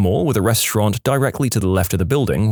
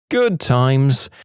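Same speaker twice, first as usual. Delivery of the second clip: wry reminiscing; 3 semitones higher than usual. Real, not acted. Sarcastic aside after near misses, twice.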